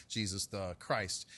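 ordinary talk

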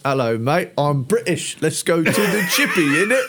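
hammed-up British accent, breaking into laughter at the end; mock British accent. Cohost hamming up a British accent for laughs, laughing. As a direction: play a cartoon Brit, cracking up at the end. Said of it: "an awful accent that sounds kind of like Eddie's".

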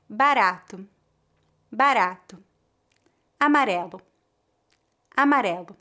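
"Ra" with a single tap of the tongue tip; tapped R. Shown as a demonstration. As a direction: flick the tongue tip once on "ra". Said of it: "Repita depois de mim para praticar".

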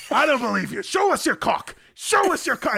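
put-on loud, high British-accented outburst; imitating a friend yelling across the room; mock yelling. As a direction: shout the demand in hammed-up disbelief. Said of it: "he started yelling".

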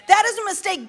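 high, forceful restatement; emphatic repeat. Acted. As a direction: hammer it home the second time.

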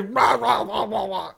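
loud, high mocking babble; obnoxious blather. Imitating the boater just sitting there, obnoxious blather. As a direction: mimic a loudmouth ranting nonsense. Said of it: "he was just this obnoxious".

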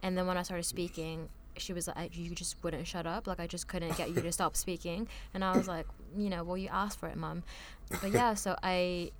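ordinary delivery

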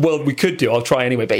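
normal talking voice

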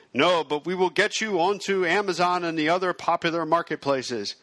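ordinary delivery

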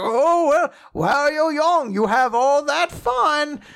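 high, slow, put-on old man voice. Old man addresses the young friends.

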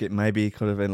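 usual speaking voice

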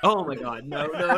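ordinary talk

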